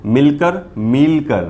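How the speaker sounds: मिलकर quick then मिलकर with a long ई, slow; short vs long ee. Shown as a demonstration. Teacher contrasting short and long ई in मिलकर. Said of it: "यही फ़र्क है दोनों में".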